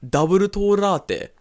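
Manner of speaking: drawn-out, high Japanese katakana English; Japanese katakana pronunciation. Shown as a demonstration. Demonstrating a coffee order, exaggerated Japanese katakana accent. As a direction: overdo the katakana syllables, slow and high. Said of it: "that's too much fucking katakana".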